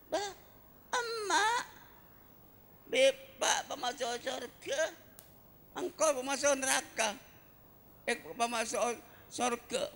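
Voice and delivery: put-on high wailing child's voice crying to God; a child protesting to God, high and pleading. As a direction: beg God like a desperate child.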